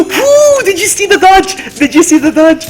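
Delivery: high, loud whoop of excitement; thrilled. Real, not acted. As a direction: boast breathlessly about a narrow escape. Thrilled by own dodge.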